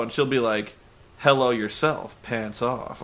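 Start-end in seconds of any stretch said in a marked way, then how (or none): 1.2-3.0 mildly mock-seductive, lowered voice; suggestive come-on. Host mimicking her reply to a hello, lightly sultry. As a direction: answer low, dropping softer at the end.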